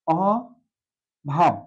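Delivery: অভাব stretched very slowly, open ɔ held; slow careful pronunciation. Shown as a demonstration. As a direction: draw out অভাব syllable by syllable. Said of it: "অয়ের উচ্চারণ অই রয়ে গেছে".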